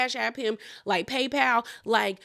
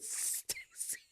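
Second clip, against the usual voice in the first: breathless whisper of Stacy; 20 dB quieter than usual. Real, not acted. Vlogger reacting to Stacy, unvoiced whisper. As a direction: breathe the name in disbelief. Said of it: "I screamed".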